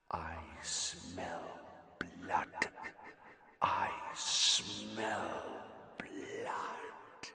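slow, breathless sinister whisper; whispering monster. A narrator's reading. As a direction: breathe it hungrily.

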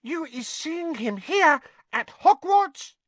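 very high, slow, amazed elf; stunned little creature, stretching each word; breathless. A narrator's reading. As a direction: squeak the question in disbelief. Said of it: "said Winky breathlessly".

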